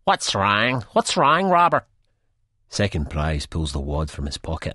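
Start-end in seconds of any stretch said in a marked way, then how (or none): 0.0-1.9 hoher, besorgter Belfast-Ton; Belfast accent. A narrator's reading. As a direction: eine besorgte Frau aus Belfast, deutlich höher. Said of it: "thick Belfast accent".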